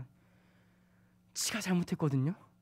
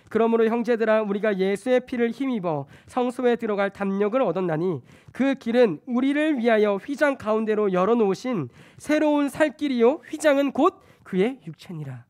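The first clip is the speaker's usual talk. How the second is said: loud, raised preaching read; a preacher booming out Hebrews; scripture reading; 5 semitones higher, 8 dB louder than usual. Acted. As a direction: proclaim the verse to the congregation. Reads Hebrews aloud.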